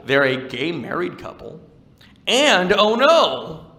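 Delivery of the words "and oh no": high, loud, drawn-out dismay; hammed-up "oh no" for a tragedy; mock dismay. Comic telling of a candy couple's breakup, mock dismay.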